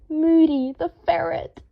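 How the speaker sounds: a happy cry of a new pet's name; crow of joy. A narrator's reading. Harry delighted, naming the ferret with joy. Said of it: "a soft crow of joy".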